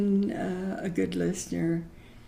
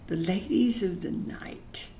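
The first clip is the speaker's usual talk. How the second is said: breathy hush on "The ladies of the night"; whispered; 5 dB quieter than usual. Real, not acted. Hushed euphemism after a pause.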